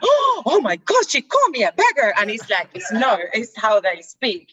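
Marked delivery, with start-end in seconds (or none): 0.0-2.1 mock shocked gasp. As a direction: replay the shock of being called a beggar, hammed up. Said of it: "I'm like".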